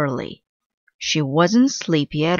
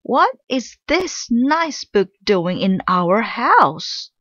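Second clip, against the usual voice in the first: outraged; 6 semitones higher than usual. Acted. Mother Witch outraged at book.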